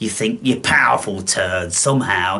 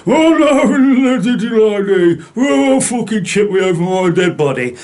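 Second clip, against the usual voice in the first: much higher and louder mock whine; mock whining protest; 10 semitones higher, 5 dB louder than usual. Mocking viewers who vow never to be chipped, high loud mock wail. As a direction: wail it like a self-pitying rebel.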